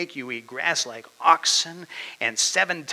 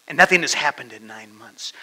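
normal talking voice

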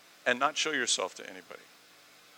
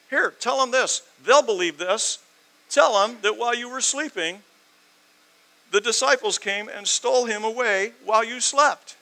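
loud, high mocking impression of schemers; mock their scheme in a raised, emphatic voice; sarcastic; 8 semitones higher, 8 dB louder than usual. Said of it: "I'm using sarcasm here".

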